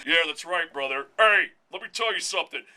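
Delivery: loud metal singer impression. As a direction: play a pushy, forceful rock frontman. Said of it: "[name] is here".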